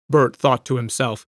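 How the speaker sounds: ordinary delivery, nothing marked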